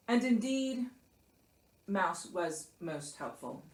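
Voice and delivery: plain reading voice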